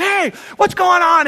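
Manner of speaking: high, acted shout at a thief; mock confrontational yell; startled angry shout. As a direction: yell out as if catching someone red-handed. Acts out catching truck thief.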